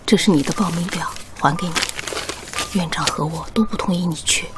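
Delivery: whispering. Acted. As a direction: whisper the bad news by the bed. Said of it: "小点声".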